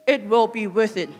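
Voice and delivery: normal talking voice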